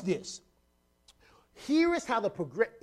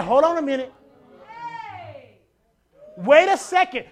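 Jesus halting; 7 semitones higher, 9 dB louder than usual. Retold or imitated. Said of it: "And he said".

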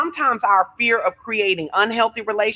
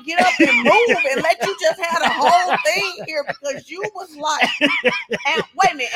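higher voice bubbling with real laughter; laughing tease; 7 semitones higher than usual. Real, not acted. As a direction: poke fun while laughing. Co-host teases the passionate speaker.

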